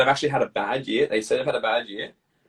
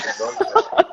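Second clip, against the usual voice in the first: laughing; 3 dB louder than usual. Real, not acted. Laughing at own idea.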